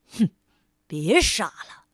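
low, dismissive "hmph, don't be silly"; scornful. Acted. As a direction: sneer it down at him. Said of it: "轻蔑地说". (轻蔑低沉地说)

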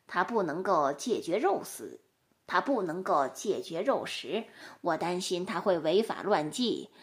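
low, subdued donkey testimony; quiet, low-pitched explanation; donkey witness. Acted.

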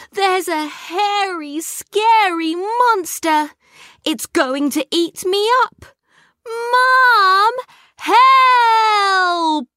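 high, loud, hammed-up cry for help; a boy faking terror to fool his dad; mock fright. A narrator's reading. Kipper tricking Dad into coming upstairs, hammed-up panic.

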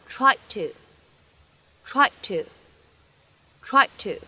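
"tried to" with the first t dropped, said slowly; slow "tried to" with lost plosion; linked reading. Shown as a demonstration. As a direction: blend "tried to", no release on the d. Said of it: "我们可以读成tried to".

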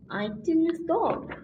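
plain reading voice

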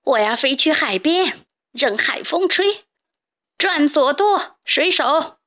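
wild captain's call; loud shout. Acted. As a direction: bellow orders from atop the barrel. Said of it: "站在木桶上大声喊叫".

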